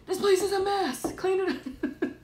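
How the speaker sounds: high hammed-up imitation of a fussy husband; mock the husband's complaint, laughing; laughing parody of a grumbling husband; mock husband grumble. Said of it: "my husband would have a fit".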